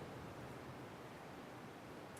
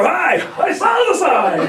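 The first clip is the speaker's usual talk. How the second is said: high, loud, slow cheery shouted greeting; 13 dB louder, slower than usual. Shown as a demonstration. Realtor greeting a grumpy seller at the door, loud cheerful shout. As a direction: beam it out like a proud salesman. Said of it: "So now I knock on the door".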